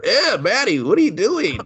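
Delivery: high-pitched parental questioning; mock startled mom. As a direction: question the daughter in a put-on high voice. Voices the mother waking up.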